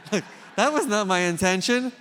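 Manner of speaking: loud and flustered, insisting it wasn't meant; comic protest. Real, not acted. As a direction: speaker protesting to the audience, high and loud. Protesting his blunder, comic.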